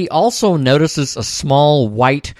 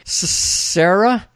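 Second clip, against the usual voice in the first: slow, higher, hesitant question; confused, remembering; 7 semitones higher, slower than usual. Retold or imitated. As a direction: stumble on the name, half remembering. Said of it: "She seems confused, as if remembering something".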